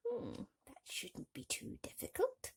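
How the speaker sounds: put-on high whispery old crone; wise old woman. The old woman answering the wife, whispered crone. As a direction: breathe it like a knowing old woman. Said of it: "replied the old woman".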